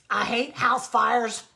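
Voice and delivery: loud, slow, curt snap; snapping. Acted. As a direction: bite the words off, irritated. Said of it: "snapped the Grackle".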